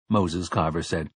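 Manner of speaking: usual speaking voice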